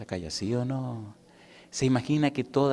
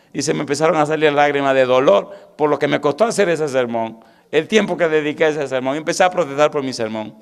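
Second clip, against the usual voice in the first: preaching loudly and high, full pulpit force; predicación con fuerza; 5 semitones higher, 8 dB louder than usual. Acted. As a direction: preach it out to the congregation with fervor. (predicando a todo pulmón)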